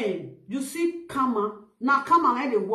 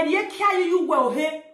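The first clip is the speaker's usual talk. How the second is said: ordinary talk, nothing marked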